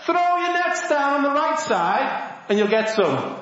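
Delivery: high, sustained calling voice; mock distant shout. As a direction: call an instruction across the water. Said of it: "And he shouts".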